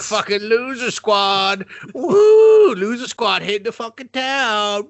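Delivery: high, drawn-out hollering with a big whoop; rowdy party whooping. Acted. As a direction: whoop it up like a hammed-up party crew. Acting out rowdy losers partying.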